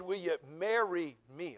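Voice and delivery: "Will you marry me?" slowly with weight on "marry"; slow, deliberate proposal. Shown as a demonstration. Preacher repeating his proposal slowly and deliberately. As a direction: spell it out word by word. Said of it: "I didn't ask you want a hamburger".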